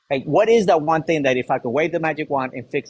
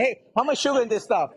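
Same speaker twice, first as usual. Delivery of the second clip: playful retold question; 8 semitones higher than usual. Retold asking everybody.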